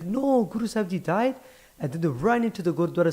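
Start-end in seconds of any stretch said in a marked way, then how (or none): 0.0-1.4 retold shock. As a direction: voice a crowd's stunned disbelief, pitched up. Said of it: "everyone gets shocked. They get scared".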